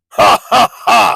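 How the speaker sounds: loud, punched villain's cackle; mocking laugh. Villain scoffing at a little sword, mocking laugh. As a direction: laugh scornfully in three hard bursts. Villain mocks an opponent's attack.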